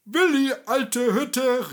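Brockmüller hails Willi across the bar, loud and hearty; hearty greeting call. A narrator's reading. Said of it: "rief Brockmüller".

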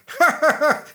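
high, loud evil witch cackle; gleeful wicked laugh; scornful cackle. Acted. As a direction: cackle in triumph.

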